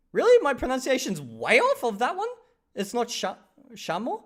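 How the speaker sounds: high, loud disbelieving really; shocked at the bad news; incredulous. Real, not acted. Told by chat his pronunciation is off, high and incredulous.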